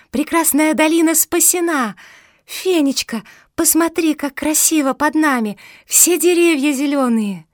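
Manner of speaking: high, loud elated exclamations; jubilant. A narrator's reading. Mafi shows Fenya the saved valley, jubilant. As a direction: gush with wonder and joy. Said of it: "ликовала Мафи".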